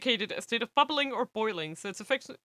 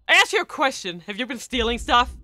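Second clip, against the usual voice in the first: loud, higher furious interrogation; angry demand; 4 semitones higher, 9 dB louder than usual. Acted. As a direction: bark the question at them. Gang leader interrogating his men.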